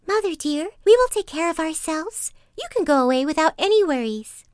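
put-on high, loud voice of eager kids; little goat kids. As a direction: reassure mother in a high little kid's voice. Kids reassure their mother.

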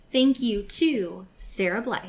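ordinary delivery

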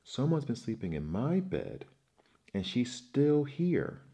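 put-on baby bear screaming in alarm. A narrator's reading. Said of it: "screamed Baby Bear".

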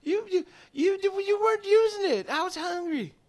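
high squeaky cat voice, stumbling into excuses; defensive cat. Acted. Cat explaining why it ate a toe, defensive squeak.

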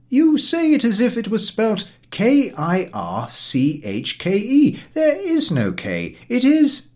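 high, loud, slow and insistent; painstaking German teacher. A narrator's reading. German teacher drilling his pupil on Kirche, insistently. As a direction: spell it out patiently to a slow pupil.

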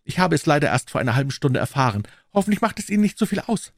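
high-pitched, apologetic lord; entschuldigender Lord. Acted. (hohe, entschuldigende Lordstimme)

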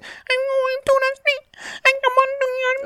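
tiny, very high baby-voiced rapper. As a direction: rap it in a squeaky little baby voice. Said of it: "that does the baby voice".

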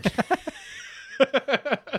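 real giggle at her own confession; high laugh. Real, not acted. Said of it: "Hold it in".

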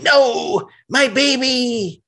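high, slow mock wail of loss; hammed-up cry over his broken toy; anguished villain. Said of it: "And he's like".

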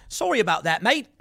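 lightly put-on British accent that slips into Australian; mock British-Australian apology; attempted British accent. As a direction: imitate a British guy, sounding rather Australian. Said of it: "a British guy kissing the boots".

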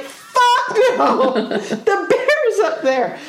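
panicked no to dad, laughing; alarmed refusal. Retold or imitated. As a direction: balk at going near the bear. Refusing dad's fishing plan.

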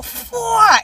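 loud, high, drawn-out whoosh; imitated gushing noise. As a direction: burst out with a long loud "fua". Imitates the gush in the story. (onomatopeya fuerte y alargada)

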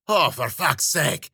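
slow, drawn-out weary groan of words; exasperated moan. A narrator's reading. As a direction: moan it slowly, mildly fed up. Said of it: "she moaned".

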